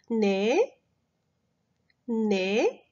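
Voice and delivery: 네 rising like a puzzled question. Shown as a demonstration. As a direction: ask 네 back as if you didn't catch it. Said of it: "이런 톤이랑 좀 비슷하답니다". (되묻는 듯 올라가는 네)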